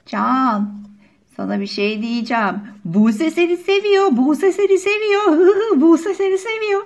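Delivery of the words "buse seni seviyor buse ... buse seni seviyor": teasing singsong. Acted. Teasing chant at a boy.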